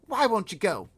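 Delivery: put-on a small girl pleading to stop the giants; high girl's voice. Girl begging the giant, high and loud.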